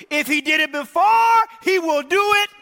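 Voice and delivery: high, loud shouted refrain; full-voiced pulpit cry, building on each word; preaching shout. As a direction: shout it out to the whole church.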